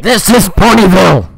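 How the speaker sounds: loud, slow, booming announcement; character shout. Pretend. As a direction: bellow it out in a pony voice.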